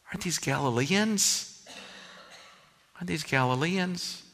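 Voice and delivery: normal talking voice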